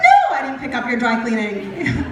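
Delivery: loud, comically indignant denial; mock indignation. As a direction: protest loudly, playing it for laughs. Acted indignant reply to the mix-up.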